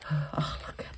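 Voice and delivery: soft breathy whisper; hushed little discovery; whispered oh look. Real, not acted. Showing a pretty bag, whispered delight.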